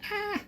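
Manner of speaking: high yelp, just got burnt; sharp cry of pain. Real, not acted. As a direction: cry out from a burn. Burns himself again.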